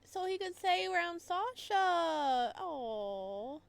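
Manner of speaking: high, loud, slow, tender dismay; high slow ache into a crying aw; heartbroken aww. Real, not acted. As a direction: melt over the sad romance. Moved by Mishka's motive.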